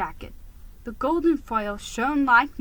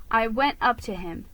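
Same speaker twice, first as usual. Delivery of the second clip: ordinary delivery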